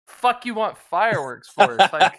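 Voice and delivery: laughing rant at cousin over fireworks in April; exasperated loud rant. Retold or imitated. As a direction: yell it at your dumb cousin, half laughing.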